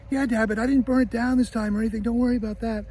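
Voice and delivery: put-on high-pitched kid explaining himself to dad; kid Kevin. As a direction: play a boy owning up to mischief, pitched well up. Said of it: "He goes".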